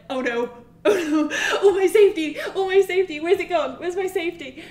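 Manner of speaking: high, loud laughing wail; mock panic. Player stripped of guns in the game, mock panic. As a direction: wail in hammed-up distress through laughter.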